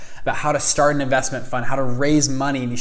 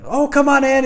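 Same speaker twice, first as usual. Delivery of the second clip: much higher, bright, friendly invitation; cheery welcome; 13 semitones higher, 6 dB louder than usual. Retold or imitated. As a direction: greet a guest brightly at the door. Son welcomes him at door.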